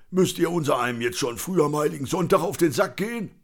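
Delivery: high, singsong complaining character voice; put-on grumpy street drinker; cantankerous Conny, griping. A narrator's reading.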